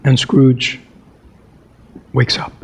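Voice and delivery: expressive storybook narration; story reading. A narrator's reading. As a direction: read it like a classic tale by the fire. Reads Christmas Carol aloud.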